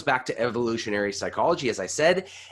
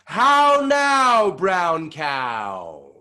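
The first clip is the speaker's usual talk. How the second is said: How now high and loud, falling to brown cow; big resonant warmup call; big face; 5 semitones higher, 8 dB louder, much slower than usual. Shown as a demonstration. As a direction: open the face wide and project. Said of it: "make our face nice and big".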